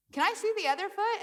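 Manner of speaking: questioning doctor. Retold or imitated. Doctor asking to check the other foot, high and puzzled. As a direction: ask on a sharply lifted pitch. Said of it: "And he's like".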